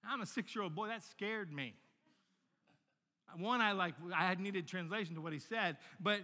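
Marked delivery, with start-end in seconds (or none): none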